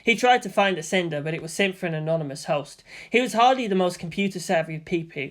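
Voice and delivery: ordinary delivery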